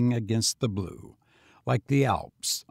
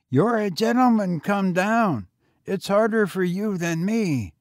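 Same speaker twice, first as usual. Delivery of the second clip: put-on higher, eager boy voice; young boy; 8 semitones higher, 3 dB louder than usual. A narrator's reading. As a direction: pitch up for a street boy. Boy continues.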